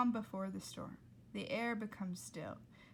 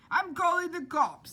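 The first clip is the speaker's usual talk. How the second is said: higher, louder blustery yell; shouting cook; 8 semitones higher, 11 dB louder than usual. Acted. Cook shouting at the diner, higher and louder. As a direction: bellow the threat at the room. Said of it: "said Ernie".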